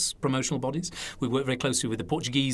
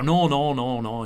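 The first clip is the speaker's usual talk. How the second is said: put-on older Lancashire man's voice; Lancashire accent. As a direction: brush it off in broad Lancashire. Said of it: "So it's my Lancashire accent there".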